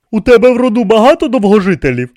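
quick, loud, high sarcastic query. A narrator's reading. King needles the priest about long-lived relatives, sarcastic. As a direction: fire the question off with mock interest. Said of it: "саркастично уточнив Тепікімон".

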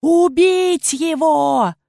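high, drawn-out shrieked command; queen's menacing cry; menacing scream. A narrator's reading. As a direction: yell the order loud and high. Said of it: "И грозно завопила".

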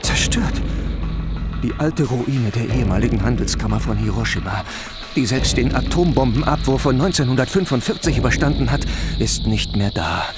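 hushed tone after the disaster; somber. Acted. Reporter nach dem Absturz. (düster und gedämpft)

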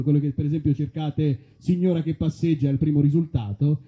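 normal talking voice

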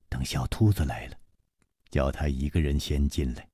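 a low, soft murmur to a companion. Acted. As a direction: drop the voice, secretive and soft. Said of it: "只听楚留香低声说". (楚留香低声吩咐同伴，压低嗓音，轻声)

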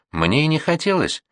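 put-on a touch of a childlike sniffling voice; sniffling like a child. A narrator's reading. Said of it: "шмыгая носом, совсем как маленькая".

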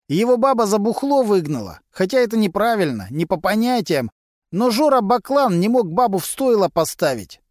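a sly garage lowlife gossiping to cops; Vasily, a gruff mechanic. A narrator's reading.

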